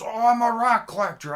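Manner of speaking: high, loud indignant shout; yelled-back excuse. Retold or imitated. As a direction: holler back as the man caught in the bushes. Caught man yells his excuse.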